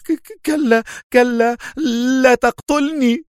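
high-pitched stammering plea; very high, panicked cry for mercy; terrified. A narrator's reading. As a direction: beg in panic, tripping on the first word. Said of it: "فانتفض هنري في ذعر وهو يهتف".

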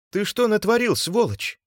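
very high, loud, strained accusation; seething, high-pitched outburst; angry hissing. A narrator's reading. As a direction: hiss furiously at the culprit. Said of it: "зашипел Субботин".

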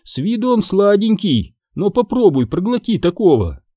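a hint of a teasing hostess; joking barmaid. A narrator's reading. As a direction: crack a joke from behind the bar. Barmaid's joke voiced in story.

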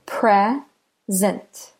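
"Present" broken in two, PRE, pause, SENT; slow "Present", a clear gap after PRE; split into syllables. Shown as a demonstration. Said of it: "Do you hear?".

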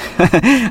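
quick rising chuckle; a bright little laugh; short laugh. Acted. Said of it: "Захар засмеялся".

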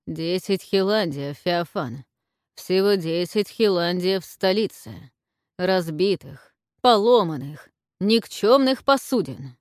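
theatrical despair, loud and slow; hammy stage actor. Acted. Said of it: "Театрально воскликнул Роман".

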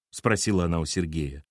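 ordinary delivery, nothing marked

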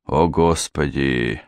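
slow, low, weary oath; despairing exclamation. A narrator's reading. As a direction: groan the words in resignation. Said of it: "в отчаянии махнул рукой Джонс". (медленно, в отчаянии)

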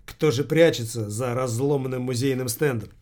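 plain reading voice

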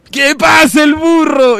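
slow, high, loud host shout; Laura shout. Retold or imitated. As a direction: bellow it like a talk-show host. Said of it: "Laura América grita". (grito de presentadora, lento y agudo)

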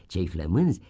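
usual speaking voice

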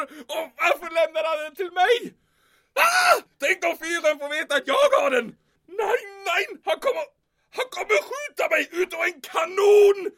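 loud, high panicked shouting; frantic, terrified officer. Acted. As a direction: panic rising as he imagines the worst. Panic over the stolen falcon.

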